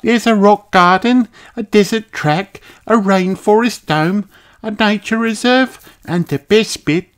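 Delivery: put-on high child voice listing treats; Kylie's chirpy high little-girl voice; eager young kangaroo. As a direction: list them eagerly, pitched well up.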